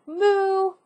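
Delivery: drawn-out cow moo; playful cow moo. Acted. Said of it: "The cow lowered".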